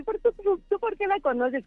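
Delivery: put-on a flustered, stammering boyfriend, pitched high; caught off guard. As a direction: play him flustered and busted.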